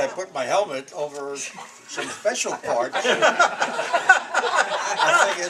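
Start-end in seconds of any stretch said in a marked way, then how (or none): 3.0-5.0 loud high laughter; real laugh, high and loud; big laugh. Real, not acted. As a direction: crack up at your own joke. Laughs about helmet joke.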